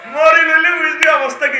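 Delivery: loud, high warning shout. Real, not acted. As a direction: shout it as a threat.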